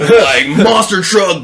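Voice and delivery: high, slow, shouted macho pitch; booming ad shout. Pretend. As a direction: yell it like a truck commercial. Mocking men's product scents.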